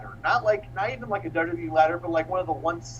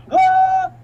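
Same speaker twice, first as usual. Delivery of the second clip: sudden piercing high shout; high yell; 11 dB louder than usual. Retold or imitated. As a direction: let out a loud wild yell mid-leap. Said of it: "He does his".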